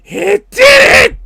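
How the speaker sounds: high, very loud excited shout. Real, not acted. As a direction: burst out shouting in triumph, drawn out. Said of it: "I got excited".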